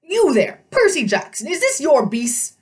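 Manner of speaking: put-on high, indignant old satyr voice; outraged old goat-man shouting; fussy old satyr. A narrator's reading.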